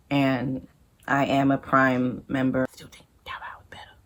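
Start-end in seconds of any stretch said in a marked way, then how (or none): 2.7-3.9 a fast whispered aside. Real, not acted. Whispered aside, still preferring Taobao to Amazon. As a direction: whisper it, as if for nobody.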